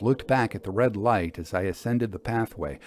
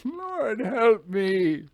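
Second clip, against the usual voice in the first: bitter despairing laugh; 10 semitones higher, much slower than usual. Acted. Signalman's despairing outburst.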